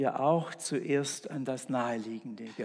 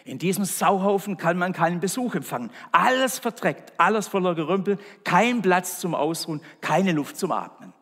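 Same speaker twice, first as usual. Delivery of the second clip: higher, louder frantic complaint; angry rant; 4 semitones higher, 7 dB louder than usual. Acted. Man panics over his dirty house, ranting loudly. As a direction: rant at the filthy mess. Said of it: "schrie er".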